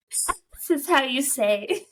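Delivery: hammed-up Slavic accent, drawn out; mock Slavic accent. Reader replaying Tony's line, mock Slavic accent. Hamming up Tony's foreign phrasing.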